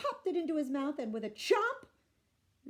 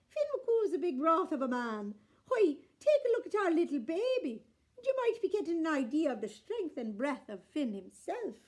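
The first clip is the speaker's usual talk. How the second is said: proud wife; 5 semitones higher, 6 dB louder than usual. A narrator's reading. Unag boasts about Finn.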